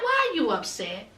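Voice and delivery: soft, low imitation of him; low offhand question. Man to upset girlfriend outside, low offhand question. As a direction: ask it casually, voice dropped. First acted take of his question.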